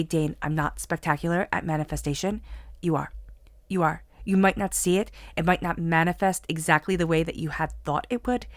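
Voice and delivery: usual speaking voice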